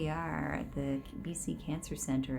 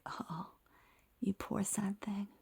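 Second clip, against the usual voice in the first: soft, high, slow imitated sympathy; mock pity; 6 semitones higher, 8 dB quieter, slower than usual. Doctor looking at a determined patient, imitated in soft pity. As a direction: coo it quietly, as if pitying someone.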